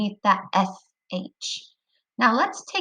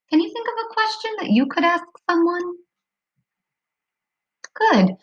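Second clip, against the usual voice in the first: ordinary delivery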